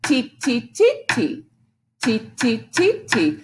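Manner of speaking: "ti, ti" as short, evenly spaced beats; teacher chanting "ti" on a steady beat; rhythm syllables. Shown as a demonstration. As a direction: tap out each "ti" with the voice. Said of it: "This is ti".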